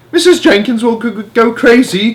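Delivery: high, loud stammering yell; father yelling in panic. Acted. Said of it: "yelled Mister Jenkins".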